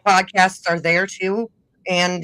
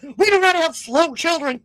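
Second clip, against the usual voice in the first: high, mock-indignant mother on the phone; hammed-up indignant objection, pitched way up; upset mom; 10 semitones higher, 4 dB louder than usual. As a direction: protest like an offended mom, imitated. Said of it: "my mom got upset".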